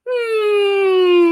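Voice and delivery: long, high, loud hum; forward-placed hum. Shown as a demonstration. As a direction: let a high hum buzz on the lips. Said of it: "When you're forward".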